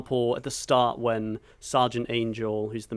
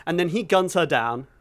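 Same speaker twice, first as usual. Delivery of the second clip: ordinary talk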